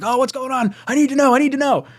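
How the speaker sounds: high, loud mock anxious fan; hammed-up frantic fan. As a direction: play the frantic fan refreshing Twitter. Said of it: "you guys are all looking at your phones and like".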